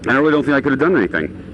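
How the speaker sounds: usual speaking voice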